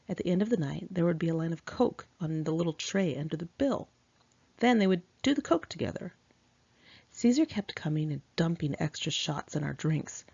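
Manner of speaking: plain reading voice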